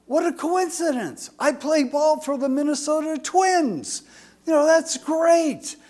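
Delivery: high, bright, pleased exclamation; delighted new dad. Acted. A new father boasting to the nurse, high and delighted. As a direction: beam with pride at the coincidence.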